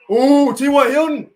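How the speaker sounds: loud, high, slow delighted cry; excited shout. Real, not acted. Sudden excited exclamation.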